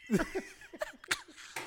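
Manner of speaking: high squawking seagull screech; seagull cry. Pretend. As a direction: squawk like a gull. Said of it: "Can you give me a seagull impression".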